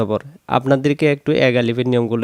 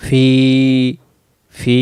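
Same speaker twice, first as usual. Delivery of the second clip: "ফি" with a long held ee; "ফি" sustained for a full beat; elongated vowel; much slower than usual. Shown as a demonstration. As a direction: stretch the vowel of "ফি". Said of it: "এক আলিফ".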